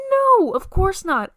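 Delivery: high, loud burst of "no"; loud denial. Retold or imitated. Answering mom's question about possession, loud high denial. Mom asks if she's possessed.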